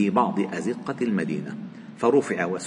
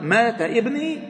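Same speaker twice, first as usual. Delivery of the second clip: mother's question; 11 semitones higher, slower than usual. Acted. Blind old mother asking again.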